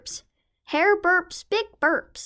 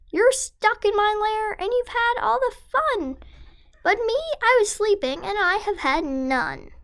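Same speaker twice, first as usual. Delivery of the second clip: higher, aggrieved bear voice; grumpy bear complaint; 7 semitones higher than usual. Acted. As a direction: whine it like a woken bear. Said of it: "Bear roars and he rumbles".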